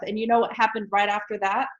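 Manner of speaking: ordinary talk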